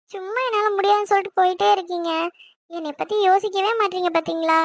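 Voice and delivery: ordinary talk, nothing marked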